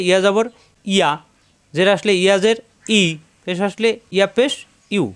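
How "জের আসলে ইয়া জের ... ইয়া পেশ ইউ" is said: ordinary talk, nothing marked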